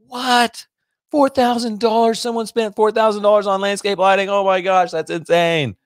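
high, incredulous squeal of shock; stunned disbelief. Retold or imitated. Retelling his shock at brother's job price, high incredulous disbelief. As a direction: react as if the price is unbelievable. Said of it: "And I was like".

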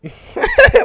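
high, loud burst of laughter; big laugh. Real, not acted. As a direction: crack up out loud. Finds report funny.

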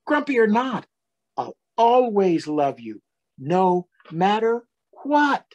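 gentle storybook read-aloud voice; reading to children. A narrator's reading. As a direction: read it like a bedtime story for little ones. Reading picture book to children.

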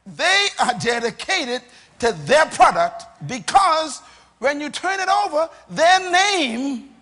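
loud, high, emphatic pulpit proclamation; full-force preaching. Real, not acted. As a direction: hammer each phrase home to the congregation.

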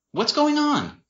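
high-pitched anxious question; concerned sister. A narrator's reading. Sister catching up with him, anxiously asking.

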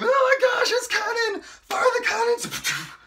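fan screaming. Retold or imitated. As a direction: squeal it like a fan losing their mind over the kiss. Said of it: "Y'all going around screaming".

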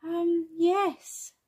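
high-pitched uncertain yes; high little-kid voice; hesitant child character. Acted. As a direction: answer reluctantly as a small child. Bing answers reluctantly.

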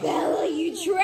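high, playful cry of betrayal; hammed-up betrayed shout; mock outrage. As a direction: call out the little traitor in mock outrage. Child names the other parent winner.

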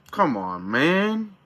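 normal talking voice

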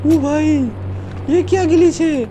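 high-pitched, incredulous outcry; astonished. Real, not acted. Gamer spotting a glitch in the game, astonished. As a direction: blurt it out high in disbelief.

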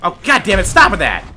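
loud gamer protest; real frustrated outburst; exasperated yelling. Real, not acted. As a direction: yell at the opponent to quit that move. Hit repeatedly in game.

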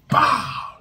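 high loud "bow!"; shouted impact sound. Shown as a demonstration. Showing viewers the strike, high loud "bow!". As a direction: shout the hit.

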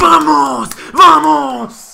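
excited shout. Real, not acted. Celebrating a goal.

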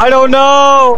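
loud, high, slow frantic cry; panicked. Real, not acted. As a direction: shout in a panic. Panic under threat.

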